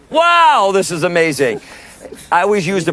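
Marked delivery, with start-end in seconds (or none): 0.1-1.6 loud, high-pitched wow; a big, soaring exclamation; amazed exclamation. Real, not acted. Reacting to listener's agreeing answer.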